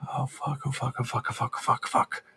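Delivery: soft, rapid panicked oh fuck on repeat; freaking out under his breath; panicked muttering. Acted. Yakim sees the creature and panics, softly cursing.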